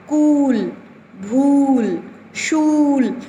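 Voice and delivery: कूल, भूल, शूल with the ऊ stretched long; teacher stretching the long ऊ; long ऊ drawn out. Shown as a demonstration. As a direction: pull the ऊ in भूल out slowly. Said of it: "थोड़ा सा खींच दिया।".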